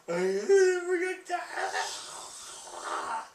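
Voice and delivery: high, slow whimpering wail; whiny scared voice. Retold or imitated. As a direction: whine it out like a coward. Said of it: "He's like".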